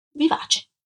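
usual speaking voice

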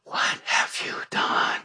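whispered, then slow, tearful and rising; seductive accuser. Acted. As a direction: hiss then wail a false accusation. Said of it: "in that seductive voice".

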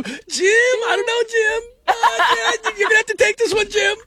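very high, squealing falsetto parody; hammed-up helpless sportscaster impression; Tony Romo. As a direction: whine it out like a baffled commentator, stretching the last words. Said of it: "just go".

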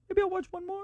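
very soft, high little voice; small, sneaky voice. Retold or imitated. Dad tempting himself after the kids' bedtime, high and hushed. Acted thought, kids in bed.

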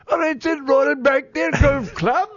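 high put-on British accent with Cockney lean. Shown as a demonstration. Golf report for co-hosts in put-on British accent. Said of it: "you're leaning Cockney".

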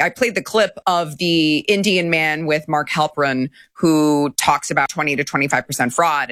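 normal talking voice

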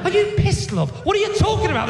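high, incredulous comic retort; mock exasperation. Acted row over spelling Siobhan.

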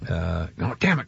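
ordinary delivery

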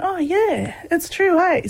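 high-pitched retold agreement; playful sudden realisation, pitched well up; dawning realisation. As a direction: act out the penny dropping. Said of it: "light bulb moment went off".

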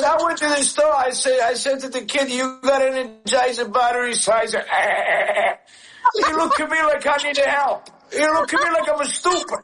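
put-on high, accented voice of his dad; dad impression. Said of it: "you put your dad's voice on".

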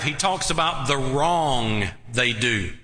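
ordinary talk

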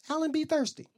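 small, high, soft voice; sheepish excuse. Retold or imitated. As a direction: offer a meek little excuse under scrutiny. Sheepish excuse to angry girlfriend.

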